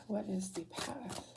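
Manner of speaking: soft, slow murmured question; quiet questioning. Real, not acted. Reader asking the path of the cards, quiet questioning. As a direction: ask it slowly under your breath.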